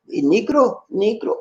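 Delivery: friendly Cebuano "Negro" greeting. Retold or imitated. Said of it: "That's how they pronounce".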